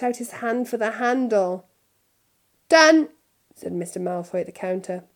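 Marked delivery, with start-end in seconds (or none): 2.7-3.1 higher, louder decisive bark; sudden done. A narrator's reading. Malfoy ending the haggle, decisive. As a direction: seal the deal.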